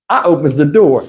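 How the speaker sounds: ordinary talk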